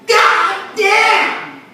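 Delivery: loud, high burst of a curse; mother yelling a curse; yelled curse. Retold or imitated. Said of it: "she yelled".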